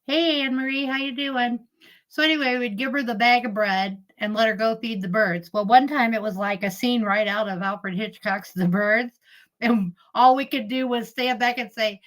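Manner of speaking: ordinary talk, nothing marked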